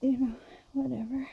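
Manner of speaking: whispered shrug; whispering. Real, not acted. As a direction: breathe it out as a throwaway aside. Muttered aside while wrapping.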